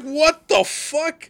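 high, drawn-out stunned what; shocked. Retold or imitated. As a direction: stretch it out in disbelief. Waking to a hundred messages.

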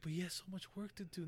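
lightly voiced pleading child; little girl's voice. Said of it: "he's like".

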